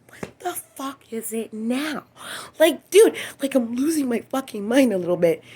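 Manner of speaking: high, loud exasperated yell; exasperated outburst. Retold or imitated. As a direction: blow up in disbelief. Retold reaction to the names.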